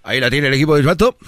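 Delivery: fast play-by-play patter; Argentine match commentator. Acted. Argentine announcer follows the ball, quick commentary. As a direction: rattle off the passes. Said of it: "En Argentina dicen".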